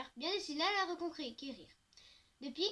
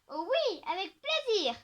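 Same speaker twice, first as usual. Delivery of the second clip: higher and louder, delighted; eager agreement; 5 semitones higher, 7 dB louder than usual. A narrator's reading. Two girls eagerly accepting the ice cream invite, higher and excited. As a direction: jump at the offer of ice cream. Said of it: "renchérissent Coco et Stevie".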